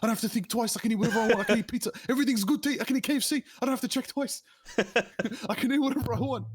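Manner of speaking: high, gleeful, laughing list of foods; high-pitched delighted mock glee; giddy excited. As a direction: rattle it off like a kid let loose in a candy shop. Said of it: "I'm like".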